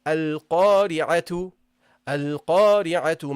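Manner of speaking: "Al-Qari'atu" recited slowly, carried on into tu; connected tu ending. Shown as a demonstration. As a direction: draw out "Al-Qari'atu" with the ta voiced as tu. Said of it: "when you carry on".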